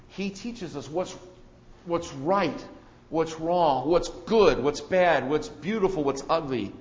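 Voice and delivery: normal talking voice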